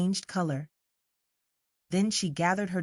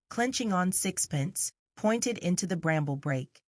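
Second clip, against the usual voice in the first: ordinary delivery, nothing marked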